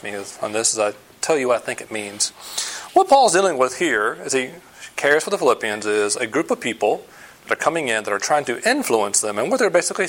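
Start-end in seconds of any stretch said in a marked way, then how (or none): none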